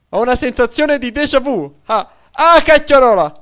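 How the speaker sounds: ordinary talk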